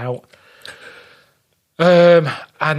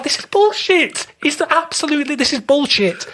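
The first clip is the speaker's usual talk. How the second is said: exasperated tourist calling out the sales pitch; incredulous outrage; 11 semitones higher than usual. Retold or imitated. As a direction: pitch it up in mock outrage at the carpets. Retold outburst in carpet shop.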